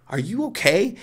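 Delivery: high, rising doubtful question; acted worried disbelief. Husband re-checking his shaking wife, acted worried disbelief. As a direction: press the question, pitch climbing.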